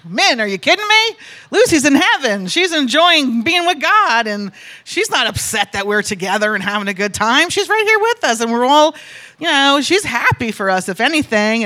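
high, loud retold reproof of a friend; emphatic scolding. Setting a sad friend straight over dinner, emphatic scolding. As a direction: exclaim it insistently, pitch leaping up.

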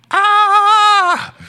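sudden high loud cry; retold yell. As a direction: let out a short sharp yell. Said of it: "'cause I was yelling, like".

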